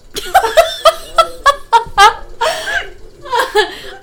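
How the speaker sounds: high, loud burst of laughter; big pealing laugh. Real, not acted. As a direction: crack up in a rapid cackle. Laughing at the video.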